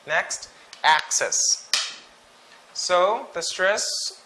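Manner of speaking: ordinary talk, nothing marked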